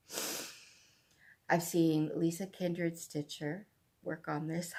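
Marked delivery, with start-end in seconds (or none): 0.1-0.5 soft, whispered thanks. Real, not acted. Relieved the chart is easy to find, whispered. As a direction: breathe out grateful relief.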